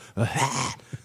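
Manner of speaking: mock preacher's hacking "hah"; imitated phlegm-clearing shout; phlegmy preacher gasp. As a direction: hack out a throaty preacher's exhale. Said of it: "he got phlegm in his throat".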